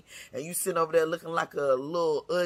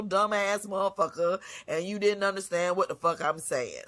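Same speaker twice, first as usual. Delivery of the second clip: normal talking voice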